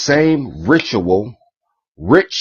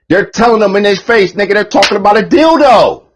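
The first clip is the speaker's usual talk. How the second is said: much higher, louder outburst; exasperated yell; 11 semitones higher, 5 dB louder than usual. Real, not acted. Telling the audience the truth, exasperated yell. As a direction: blurt it out in disbelief.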